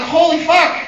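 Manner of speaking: high, loud stunned curse; a high, loud "holy fuck" of amazement; amazed exclamation. Retold or imitated. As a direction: blurt it out in disbelief. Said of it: "And I was like".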